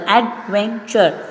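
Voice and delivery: "adventure" を音節ごとにゆっくり区切る; slow syllable by syllable. Shown as a demonstration. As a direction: break "adventure" into three slow beats. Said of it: "we have three syllable".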